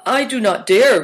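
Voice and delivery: a young character voicing his reluctance timidly; high-pitched, hesitant refusal; timid boy. A narrator's reading.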